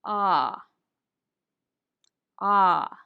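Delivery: open-throat "Ah" with the jaw dropped; "Ah" as if showing your throat to the doctor; doctor's ah. Shown as a demonstration. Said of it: "the sound that you make when the doctor wants to see your throat".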